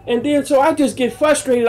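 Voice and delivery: normal talking voice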